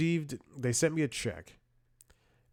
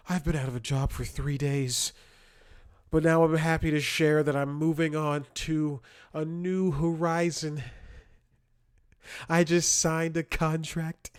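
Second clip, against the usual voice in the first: higher, louder, smug parody; mocking; 7 semitones higher, 4 dB louder than usual. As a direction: sneer out a fake humblebrag. Mocking LinkedIn posters.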